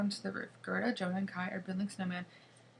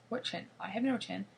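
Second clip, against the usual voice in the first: plain reading voice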